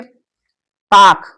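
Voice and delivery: ताक stretched very long and said loud; drawn out. Shown as a demonstration. Teacher introducing a new word to students, stretched and loud. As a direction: announce the word ताक, drawing it out. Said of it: "अब ताक का अर्थ क्या है?".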